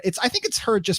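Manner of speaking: usual speaking voice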